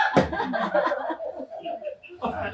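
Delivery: real laugh at his own joke. Real, not acted. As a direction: burst out laughing. Laughs after mock praise. (totoong tawa)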